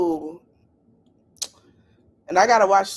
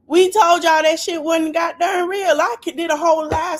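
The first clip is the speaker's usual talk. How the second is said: exasperated shout; 9 semitones higher, 5 dB louder than usual. Real, not acted. Vindicated, told you so.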